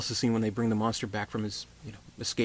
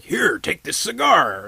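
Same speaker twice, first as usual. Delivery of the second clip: slow, higher mock voice of the blind host; lightly voiced blind man, slow and higher; 7 semitones higher, 7 dB louder, slower than usual.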